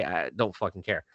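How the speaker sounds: usual speaking voice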